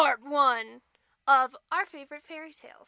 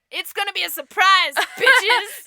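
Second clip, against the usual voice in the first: teasing shout; 2 semitones higher, 8 dB louder than usual. Real, not acted.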